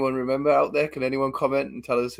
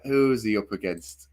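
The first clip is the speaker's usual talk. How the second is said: ordinary talk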